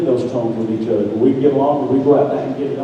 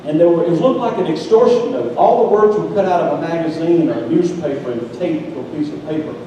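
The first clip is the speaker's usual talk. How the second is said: usual speaking voice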